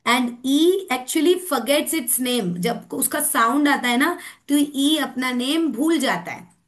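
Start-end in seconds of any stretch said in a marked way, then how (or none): 0.1-2.6 put-on slow, clear storytelling for kids; slow, deliberate classroom delivery; slow teaching voice. Shown as a demonstration. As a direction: say it slowly as if telling children a tale. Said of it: "ऐसे बच्चों को सिखाना है".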